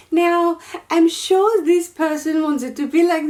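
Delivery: high mock French accent. Designer mocking a customer's wishes, high French accent. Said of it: "I'm doing a French accent".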